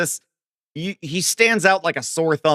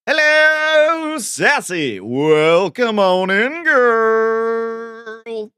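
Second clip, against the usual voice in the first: high, loud, long-held welcome call; exuberant drawn-out greeting; 8 semitones higher, 5 dB louder, much slower than usual. Real, not acted. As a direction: holler it out, stretching the last word. Calling out a greeting to newcomer.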